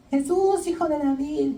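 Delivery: high-pitched call, not really shouted; mock crying out. Blind Bartimeo calling to Jesus on the road, mock crying out. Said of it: "gritando por el camino y gritando".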